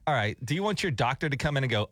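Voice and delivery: normal talking voice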